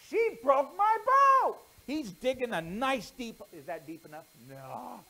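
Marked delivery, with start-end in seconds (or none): 0.1-1.6 put-on high, loud, slow childish taunt; sing-song it like a spiteful little brother; gloating boy. Steve gloats burying sister's doll.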